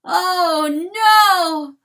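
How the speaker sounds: loud, high cry of horror; horrified cry. A narrator's reading. Rooster finding his dog's bones, crying out high and loud. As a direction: cry out at the sight.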